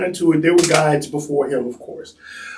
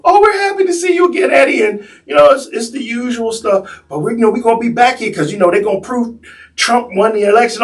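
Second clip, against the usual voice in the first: tipsy customer impression; 6 semitones higher than usual. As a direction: ham up the tipsy cheerful tourist. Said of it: "So the tour starts off".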